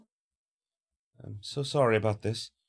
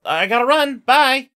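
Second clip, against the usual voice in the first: higher and louder, quick bright farewell; cheery goodbye; 11 semitones higher, 12 dB louder than usual. Acted. Girl leaving her mother for school, hurried loud goodbye. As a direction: toss off a goodbye on the way out.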